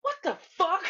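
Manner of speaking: high-pitched mock disbelief, cracking up; baffled outburst. Slim watching the women admire Omar, baffled outburst. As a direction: squawk it like he can't believe it. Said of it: "Slim was, like, truly baffled".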